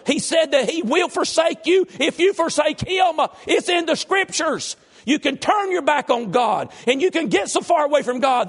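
high, loud preacher shouting his point; full-force preaching. Real, not acted. Preacher exhorting the congregation, shouting high and loud. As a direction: preach it at the top of your voice.

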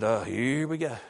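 quiet drawled imitation of his wife; mimicking his wife. Wife voiced in a story.

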